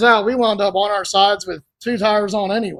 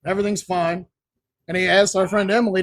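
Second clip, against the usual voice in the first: ordinary talk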